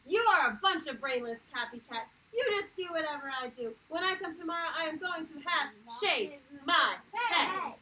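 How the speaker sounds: loud defiant yell; child yelling at her classmates. A narrator's reading. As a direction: shout at the copycats. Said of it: "Stephanie yelled".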